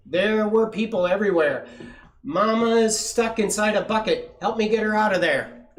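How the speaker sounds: loud, high-pitched alarm; frantic. A narrator's reading. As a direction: blurt out the bad news urgently. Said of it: "she panted, frantic".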